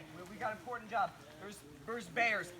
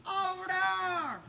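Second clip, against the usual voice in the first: high, loud cartoonish yelling of the warning; hammed-up high shouting back and forth; panicked bear warning; 9 semitones higher, 7 dB louder, much slower than usual. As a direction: shriek it down the line like the bear is right there.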